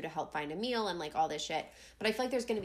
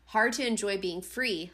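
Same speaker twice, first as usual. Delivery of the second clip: ordinary talk, nothing marked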